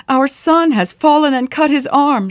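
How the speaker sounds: high-pitched distressed wail. A narrator's reading. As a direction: wail it like a panicked mother. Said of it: "she wailed".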